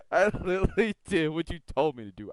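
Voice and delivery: laughing. Real, not acted. Laughing about mic mishap.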